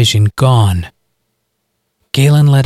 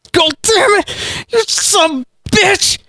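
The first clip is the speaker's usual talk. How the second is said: stabbed hillbilly shrieks curses at Galen, very high; hillbilly shrieking. A narrator's reading. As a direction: shriek it in agony and rage. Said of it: "the hillbilly shrieked at Galen".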